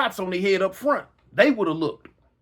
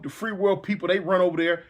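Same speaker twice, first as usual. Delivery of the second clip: normal talking voice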